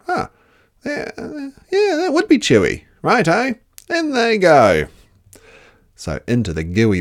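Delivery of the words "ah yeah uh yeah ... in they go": high, loud, cheeky Dingo; a greedy dog egging the others on. Acted. Dingo cheers Platypus tipping mud in.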